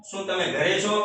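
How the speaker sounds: ordinary delivery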